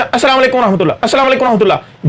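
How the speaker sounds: fast, slurred अस्सलाम वालेकुम व रहमतुल्लाह; teacher mimics people rushing the salam, fast; rushed. Shown as a demonstration. Gabbled salam. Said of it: "जल्दी से बोल देते हैं".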